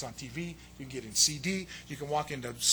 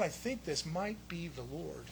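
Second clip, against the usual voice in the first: understated realization; 3 semitones lower, 12 dB quieter, slower than usual. Retold or imitated. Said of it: "I think Paul says".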